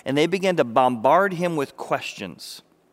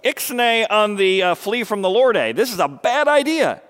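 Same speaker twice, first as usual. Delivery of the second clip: louder, higher gruff warning; a Marine warns Jonah, higher and louder; Marine's warning; 6 semitones higher, 6 dB louder than usual. Retold or imitated. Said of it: "is what you'd think if you're a Marine".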